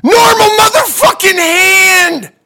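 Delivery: full-volume, high-pitched comic tirade; outraged shout. Acted. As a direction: yell the punchline, stretching the final words. Outraged punchline of his story.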